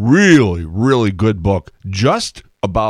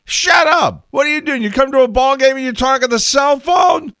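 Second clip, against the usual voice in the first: much higher mock shout at a rude fan; hammed-up angry crowd telling him off, pitched way up; annoyed fans yelling; 3 dB louder than usual. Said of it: "people start turning around going".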